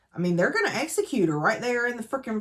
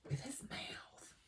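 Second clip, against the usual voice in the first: unvoiced, much softer and slow; whispered aside; 6 semitones higher, 21 dB quieter, slower than usual. Real, not acted. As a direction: whisper it like a naughty secret. Scandalised aside about a kiss.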